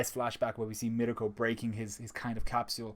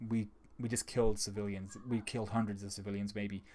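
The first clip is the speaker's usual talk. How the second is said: mock regret; 3 semitones lower, 8 dB quieter than usual. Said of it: "looking back with complete regret".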